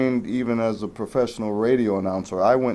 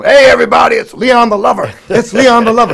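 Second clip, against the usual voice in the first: booming high announcer call, much louder; Ali announcing; 13 semitones higher, 12 dB louder than usual. Retold or imitated. Ali hailing his friend as he walks in, loud high announcer call. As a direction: herald the entrance like a ring announcer. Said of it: "Like when I'd walk into the room".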